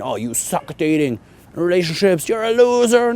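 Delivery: high, jeering put-on voice; mock scolding. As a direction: sneer like someone on a high horse.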